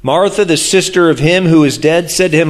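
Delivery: ordinary talk, nothing marked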